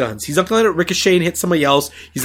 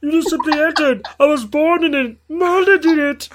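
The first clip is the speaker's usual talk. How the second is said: menacing villain, played for laughs; Bane voice; 12 semitones higher than usual. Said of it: "Bane quote".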